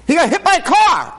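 very high, loud sarcastic jab; mock outrage. Preacher mocking parents who reward the accident, very high sarcastic. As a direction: squeal it in mock disbelief.

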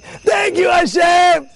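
high, jubilant call to heaven; acted grateful cry. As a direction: cry out thanks as if just saved. Acts man's grateful cry after sinking.

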